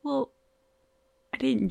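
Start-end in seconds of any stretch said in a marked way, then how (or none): none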